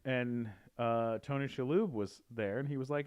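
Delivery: ordinary talk, nothing marked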